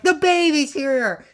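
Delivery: high, loud mock yell; urgent cry. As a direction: yell it as a woman in labor. Jean in labor announcing the birth.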